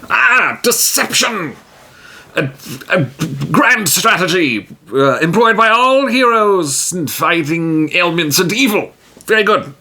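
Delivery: a proud hero booming praise; grand hero voice. Acted. T-Jim voiced in solo game.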